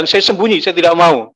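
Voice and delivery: loud, emphatic refusal; retold refusal. Man recalls hiding from court summons, loud retold refusal. Reliving his refusal to sign.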